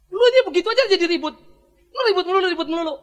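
hammed-up nagging reply; mock scolding wife. Wife snaps back at the pastor, mock scolding. Wife's retort retold.

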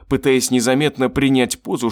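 usual speaking voice

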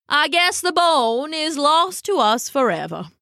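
high theatrical lament in a raccoon voice; sad raccoon. Acted. Raccoon telling the dog the bone is lost, sadly. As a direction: mourn grandly, as a pompous raccoon. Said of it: "the raccoon said sadly".

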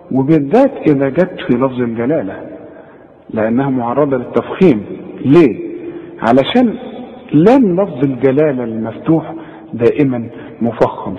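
ordinary talk, nothing marked

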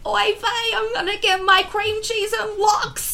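put-on high, slow joking Yiddish accent; hammed-up Yiddish accent, drawn out. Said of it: "he'd always make a joke".

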